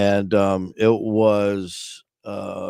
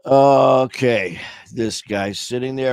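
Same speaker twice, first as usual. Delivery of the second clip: usual speaking voice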